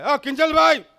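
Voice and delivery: high, loud anxious-sounding call; hammed-up shouted question; husband on speakerphone. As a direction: call out loudly into the phone. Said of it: "તો કિંજલભાઈને સ્પીકરમાં phone કરે".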